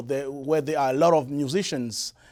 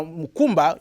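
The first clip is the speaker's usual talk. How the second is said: normal talking voice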